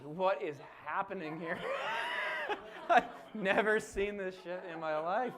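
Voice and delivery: loud laughing outburst; laughing amazed quote. Retold or imitated. Laughing loud, voicing the amazed nurse. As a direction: blurt it out, cracking up.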